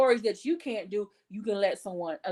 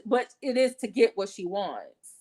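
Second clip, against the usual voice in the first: normal talking voice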